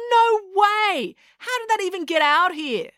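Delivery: high-pitched, astonished outburst; incredulous cry. A narrator's reading. April hears there is a bear, incredulous cry. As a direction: exclaim in sheer disbelief. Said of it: "cried April".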